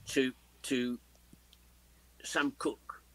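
normal talking voice

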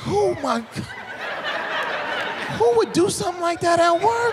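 hammed-up shocked yell; mock outrage. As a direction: shout it like you're scandalized. Discovering bathroom stink.

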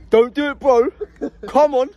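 high, loud pleading; loud begging call; begging loudly. Real, not acted. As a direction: call out to stop him, voice up high. Pleading with a friend.

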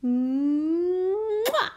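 long hummed smooch ending in a pop; high, exaggerated kiss; big kiss sound. A narrator's reading. Mum kissing a sore knee better, big smacking kiss. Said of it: "Mum kissed the smelly knee better".

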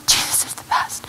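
put-on hushed, breath-only child's whisper; tired child whispering. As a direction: whisper it like a sleepy little boy repeating himself. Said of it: "he'd just say over and over".

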